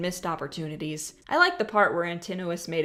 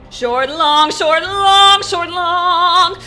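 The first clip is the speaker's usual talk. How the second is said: loud, much higher chant with "long" hit hard; rhythm demo; 10 semitones higher, 12 dB louder, much slower than usual. Shown as a demonstration. Reviewer chants the iambic beat, loud and high. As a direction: beat it out: short, punched "long". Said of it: "the rhythm of this song's hook is an iambic trimeter".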